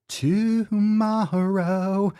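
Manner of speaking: slow, high drawn-out announcer call. Pretend. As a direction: stretch it like a hype announcer. Announcing the live masterclass.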